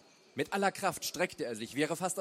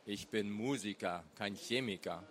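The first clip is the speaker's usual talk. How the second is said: low, weary protest; understated groan, pitched down; 5 semitones lower, 3 dB quieter than usual. A narrator's reading. Husband blamed for using petrol, groaning defence. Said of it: "Peer stöhnte".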